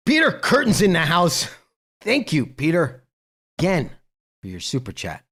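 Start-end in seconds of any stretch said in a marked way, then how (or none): 0.1-3.9 high, loud welcome to a viewer; excited shout-out. Real, not acted. Streamer spots a donor in the chat, excited shout-out. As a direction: call it out high and loud, like announcing an arrival.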